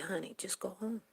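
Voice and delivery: quiet whispered advice to a daughter; mother quietly telling her crying daughter to go home; soft motherly advice. Retold or imitated. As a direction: hush it gently, like a mother soothing. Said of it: "And I said".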